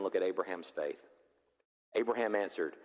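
usual speaking voice

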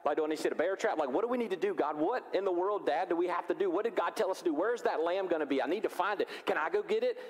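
put-on eager excited kid. Isaac voiced eager to dad.

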